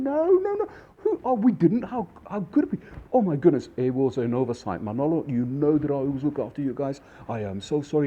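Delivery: high, loud imitation of mortified guests; flustered apology. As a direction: protest in a hammed-up fluster.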